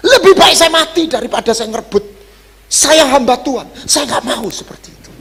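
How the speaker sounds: high, loud impassioned vow; fervent declaration. Acted. Preacher voicing a servant's vow to the congregation, impassioned and high. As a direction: cry it out like a vow before God.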